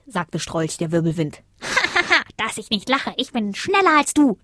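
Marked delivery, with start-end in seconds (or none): 1.6-2.3 high scoffing cackle; high staccato ha-ha of scorn; mocking laugh. Zack scoffs at his rival. 2.4-4.4 high, boastful comeback; scoff, then rise into a boast; mildly mocking pitched-up retort. A narrator's reading. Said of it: "entgegnete Zack".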